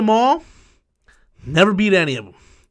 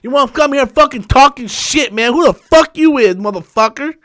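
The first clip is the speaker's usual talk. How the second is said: higher, louder raging insults; angry tirade; 6 semitones higher, 4 dB louder than usual. Real, not acted. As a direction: lash out at the chat troll. Cursing the troll.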